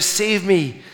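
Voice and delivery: high, retold call up the gully; crying out for rescue. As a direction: act out calling for anyone above, fading slightly. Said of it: "He cried out".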